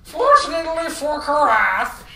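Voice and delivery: put-on high, loud old man voice. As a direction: bellow it like an old storyteller. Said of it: "how did I do the old man voice?".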